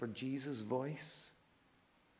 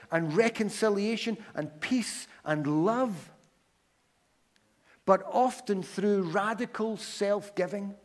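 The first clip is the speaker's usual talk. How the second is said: high, loud, driving sermon delivery; impassioned preaching; 5 semitones higher, 7 dB louder than usual. Real, not acted. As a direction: preach it with mounting fervour, raised pitch and volume. Preaches at full force.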